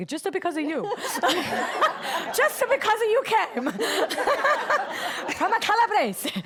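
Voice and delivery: high, loud, laughing put-on voice of the dad; voicing the dad. Medium voicing a client's late dad, giggling, high and loud. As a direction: giggle through his words in a silly high voice. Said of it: "I have no idea why I'm talking like this".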